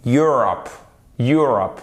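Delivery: Europe as YUR-up with a short first vowel. Shown as a demonstration. Teacher drilling the word for learners, short first vowel. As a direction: keep the start of Europe short. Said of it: "C'est avec un O court".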